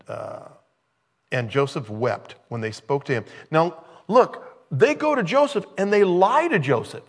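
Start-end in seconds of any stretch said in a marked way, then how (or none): 3.5-7.0 higher and louder, pointing out the lie; emphatic preaching. Real, not acted. Preacher exposes brothers' lie to the congregation, high and loud. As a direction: hammer home that they lie.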